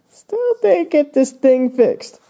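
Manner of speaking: high, slow cartoon voice; Sharpie. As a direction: put on a high-pitched, drawn-out complaint. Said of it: "Sharpie, he's like".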